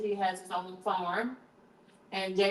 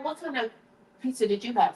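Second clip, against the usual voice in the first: usual speaking voice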